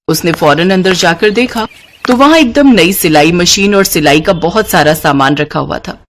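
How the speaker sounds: ordinary delivery, nothing marked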